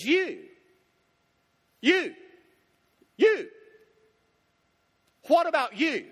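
insistent, climbing "You, you, you, what about you?"; building emphasis on you. Shown as a demonstration. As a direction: hammer "you" again and again, rising to a near shout on "you, you, you". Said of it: "the question here is emphatic".

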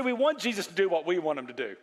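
ordinary delivery

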